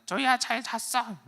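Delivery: very high, slow toy parroting back; 말하는 선인장 흉내. Retold or imitated. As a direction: echo it like a talking cactus toy. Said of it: "말하는 선인장". (선인장 인형처럼 높고 느리게 따라하기)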